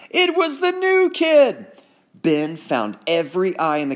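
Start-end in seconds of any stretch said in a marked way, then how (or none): 0.1-1.7 high, loud, slow announcement; raised voice. Acted. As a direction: shout it to the whole cafeteria. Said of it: "He raised his voice".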